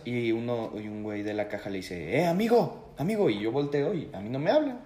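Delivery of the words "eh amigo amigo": raised, higher calling voice. Retold or imitated. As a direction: call across the store. Cashier calling out to friend.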